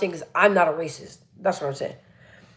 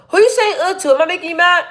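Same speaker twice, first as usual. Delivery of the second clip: much higher, louder mock-offended question; mock indignation; 8 semitones higher, 4 dB louder than usual. Real, not acted. Streamer mock-offended at a viewer's ugh. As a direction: pretend to be offended.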